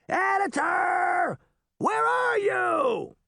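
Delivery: long, high-pitched holler; full-voiced yell, very slow and high; calling out. A narrator's reading. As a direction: shout for someone who is missing, stretching each word. Puck calling out over noise.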